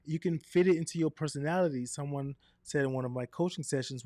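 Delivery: ordinary delivery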